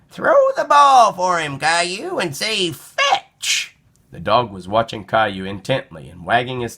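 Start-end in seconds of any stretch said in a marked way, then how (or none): 0.1-3.8 high, loud cheering granny; grandma voice. A narrator's reading. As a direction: urge him on like a grandma.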